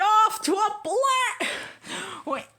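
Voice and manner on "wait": ordinary delivery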